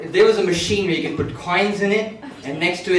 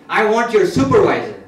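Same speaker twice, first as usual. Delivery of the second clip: loud, higher-pitched irate demand; heated complaint, voice raised; angry; 4 semitones higher, 7 dB louder than usual. Acted. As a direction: demand it like a fed-up customer. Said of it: "the guy's getting angry".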